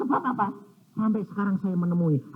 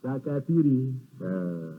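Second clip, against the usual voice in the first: ordinary talk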